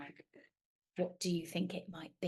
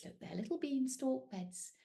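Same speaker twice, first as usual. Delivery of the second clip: ordinary delivery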